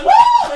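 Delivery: high, loud whooping cheer; excited whoop. Retold or imitated. Friends retelling their reaction to the stabbing, excited whoop. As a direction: let out a piercing woo.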